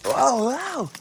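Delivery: high startled wow; surprised wow. Real, not acted.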